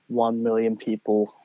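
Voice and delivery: normal talking voice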